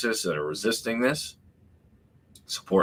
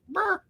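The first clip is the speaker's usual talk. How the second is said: mock buzzer. Buzzer noise after guard quote.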